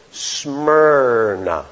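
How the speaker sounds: Smyrna drawn out very slowly, sound by sound. Shown as a demonstration. Preacher has listeners hear the city's name, Smyrna said slowly. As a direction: stretch out Smyrna, lingering on each syllable. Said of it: "I'll say it slowly".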